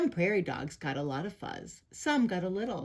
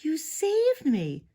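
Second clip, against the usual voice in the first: high, slow, moved cry; drawn-out, high wail of gratitude; 8 semitones higher, slower than usual. A narrator's reading. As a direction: marvel tearfully at being rescued. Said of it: "Big Bark cried".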